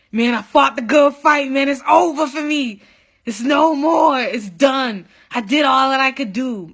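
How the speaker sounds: emphatic re-enacted giving up; despairing outburst, re-enacted. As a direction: cry out that you're finished. Re-enacting despairing call to brother.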